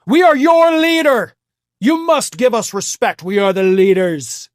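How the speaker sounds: hammed-up arrogant leader voice; mock pompous Trump. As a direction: play him as a puffed-up self-declared king. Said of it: "that's how Donald Trump views himself".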